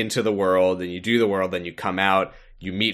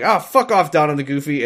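higher, louder mock dismissal; mock-rude Sora, higher and louder; Sora snapping; 6 semitones higher, 5 dB louder than usual. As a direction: brush them off rudely, hammed up. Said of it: "Sora's like".

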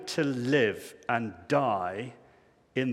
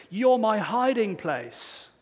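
slow, higher and confident, every word leaned on; joyful and emphatic; 7 semitones higher, slower than usual. Acted. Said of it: "With joy and confidence, it's emphatic".

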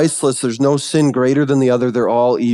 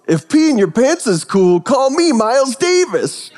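put-on old lady line; 11 semitones higher than usual. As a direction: deliver the classic line with relish. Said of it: "I gotta say it. She goes".

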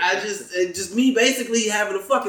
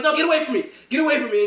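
mock alarmed refusal; 8 semitones higher, much faster than usual. Retells refusing an unwanted hug.

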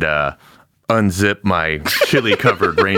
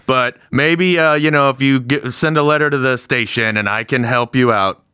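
proud champion; 6 semitones higher than usual. Acted. [name] in character, blinded champion.

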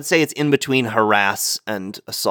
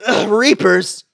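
high, exasperated groan; acted high-pitched disgust; disgusted dismay; 9 semitones higher, 3 dB louder, slower than usual. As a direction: groan at unwelcome enemies. Character reacts to enemies appearing.

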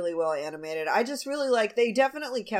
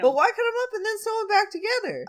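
very high, incredulous questioning; mock bafflement; 13 semitones higher, 3 dB louder than usual. Podcaster voicing a character puzzled by stitched corpses, mock baffled. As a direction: pitch it way up in exaggerated disbelief.

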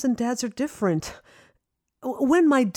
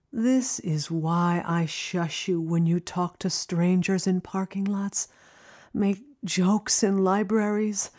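tender mother; 2 semitones lower than usual. Acted. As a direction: draw her close, gently. Mother softens to daughter.

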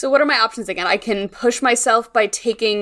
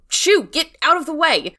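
yelling to shoo; 7 semitones higher than usual. Acted. Isaac throws dishes at the cat, mock yelling. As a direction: shoo it off in a high acted voice.

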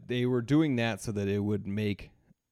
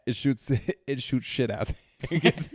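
laughing; 4 semitones higher than usual. Real, not acted. Joking about a shit-shooting pen.

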